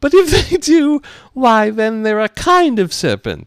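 very high, loud bird voice; emphatic put-on pigeon squawk; dismissive pigeon. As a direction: screech the retort, slowing at the end. Pigeon's retake of retort.

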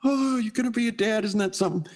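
high-pitched imitation of delighted family; gushing congratulations. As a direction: gush over a baby on the way. Family gushes at the news.

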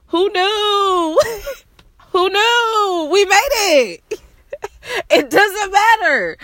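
high, cracking, half-crying laugh; overjoyed and tearing up, voice breaking high; laughing through tears. Real, not acted. Telling viewers they made it, crying-laughing in real joy. Overwhelmed, celebrating making it.

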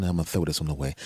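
low, soft self-talk; muttered to himself. Retold or imitated. At the grill, muttering to himself. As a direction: mutter it under your breath.

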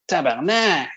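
high-pitched, impressed swear; excited exclamation. Shown as a demonstration. Showing co-hosts how one exclaims over a friend's new car, excited. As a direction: blurt it out, impressed. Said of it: "an excitement is like".

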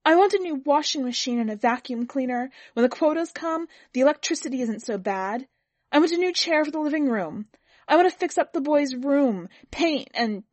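high, loud wife listing her wants; heated insistence. A narrator's reading. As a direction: press each demand urgently.

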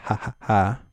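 ordinary delivery

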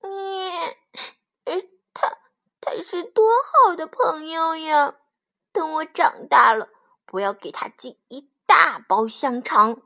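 high sobbing lamb voice; tearful, high-pitched child's lament; crying little lamb. A narrator's reading. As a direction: wail through tears as a small lamb. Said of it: "他哭了".

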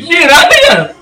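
laughing disbelief. Real, not acted. Reacting to a friend's pick, laughing high. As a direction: squeal the name through laughter.

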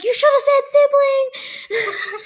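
high, drawn-out mock outcry; retold exclamation. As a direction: stretch it out high, half laughing at yourself. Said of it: "I was like".

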